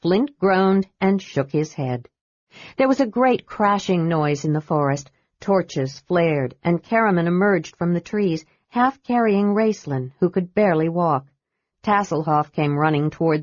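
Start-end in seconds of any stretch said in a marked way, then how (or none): none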